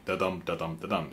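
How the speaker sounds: da-dum with dum stressed, falling off; three quiet beats, weight on dum; line of three beats. Shown as a demonstration. Songwriter showing the shorter line, dum stressed. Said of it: "followed by a line of three".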